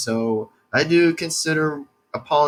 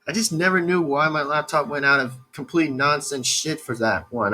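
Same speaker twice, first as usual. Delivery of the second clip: normal talking voice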